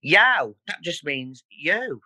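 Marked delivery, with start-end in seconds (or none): 0.0-0.5 "Yow" rhyming with "cow", louder. Shown as a demonstration. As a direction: punch out a broad "Yow". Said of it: "what about yow?".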